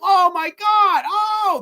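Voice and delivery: high, drawn-out horrified wail; hammed-up shout of disgusted panic; mock freak-out. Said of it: "They're freaking the fuck out".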